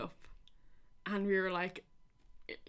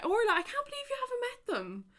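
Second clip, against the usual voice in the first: high excited squeal of disbelief. Real, not acted. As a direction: gush at Orla, much higher. Gushing at a fan's message.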